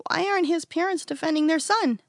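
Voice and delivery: put-on a young kid asking, puzzled; child's voice. A narrator's reading. A kid among friends asking why, lightly childlike voice.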